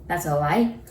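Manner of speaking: ordinary talk, nothing marked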